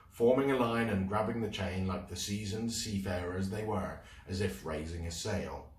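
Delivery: ordinary delivery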